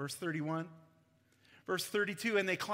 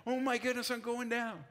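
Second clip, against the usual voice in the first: calling out; 5 semitones higher than usual. Acted. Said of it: "calling out like Jesus is miles away".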